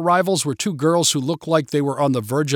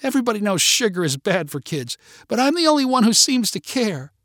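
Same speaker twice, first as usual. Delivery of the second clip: high, self-pitying complaint; pouting; 8 semitones higher than usual. A narrator's reading. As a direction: whine as the only one who cares. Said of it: "she said with a pout".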